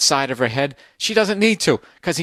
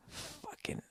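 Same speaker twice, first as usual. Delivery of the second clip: exasperated whisper; 24 dB quieter than usual. Real, not acted. Exasperated aside after story.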